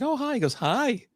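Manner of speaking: very high, sing-out hello; mock cheery greeting. Girl greeting him after her wish, mock cheery. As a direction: pitch the hello way up. Retelling girl's sudden sweet greeting.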